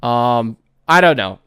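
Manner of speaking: normal talking voice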